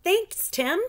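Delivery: high, bright thanks; delighted with the drawing; girl thanking a friend for his drawing; pleased. Acted. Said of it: "she said".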